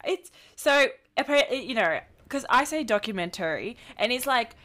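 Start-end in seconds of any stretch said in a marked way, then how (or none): 2.3-3.8 "documentary" with the -tary fully pronounced, -terry; "documentary" with a strong -terry ending; American documentary. Shown as a demonstration. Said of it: "the Terry is so announced".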